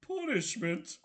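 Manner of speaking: a wounded big lug pleading; detective protesting to the prosecutor, whiny. Acted. Gumshoe voice again.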